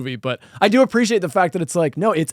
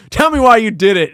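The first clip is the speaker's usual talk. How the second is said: demanding detective; 6 semitones higher, 6 dB louder than usual. Retold or imitated. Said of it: "The detective's like".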